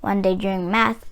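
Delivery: ordinary delivery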